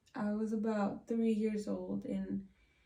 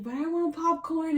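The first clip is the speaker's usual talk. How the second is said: put-on higher, louder whiny-kid protest; mock tantrum, pitched up and insistent; child's tantrum; 8 semitones higher, 6 dB louder than usual. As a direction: act out a small child throwing a fit. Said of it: "so I threw a fit".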